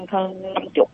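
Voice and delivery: trailing off softly; quiet. Real, not acted. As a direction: say the amount barely above a mumble. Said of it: "Bao nhiêu?".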